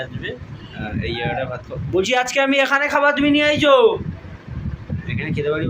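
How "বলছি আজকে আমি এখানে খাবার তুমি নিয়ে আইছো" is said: high shout to someone far away; calling out loudly. Real, not acted. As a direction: call across the yard. Calling mother from afar.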